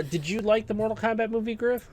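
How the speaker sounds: ordinary talk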